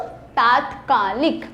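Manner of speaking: तात्कालिक said slowly, syllable by syllable; slow model word. Shown as a demonstration. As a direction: spell out तात्कालिक slowly for the class. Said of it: "क्या होता है बच्चों?". (तात्कालिक धीरे-धीरे)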